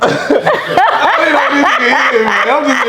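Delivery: the same denial laughed out, pitched high; laughing retelling of the denial. Real, not acted. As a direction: repeat it cracking up. Repeats it laughing.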